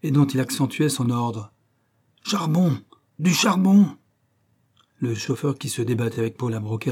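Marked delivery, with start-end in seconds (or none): 2.2-3.9 much higher and louder yelled command; shouted order. Acted. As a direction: bellow for coal. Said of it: "il lança le juron habituel". (le mécanicien crie l'ordre, beaucoup plus haut et fort)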